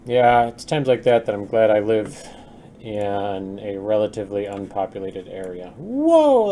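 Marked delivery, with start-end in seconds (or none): 5.8-6.5 startled high whoa; surprised whoa. Real, not acted. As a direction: caught off guard, yelp it. Sculpt tool misfires.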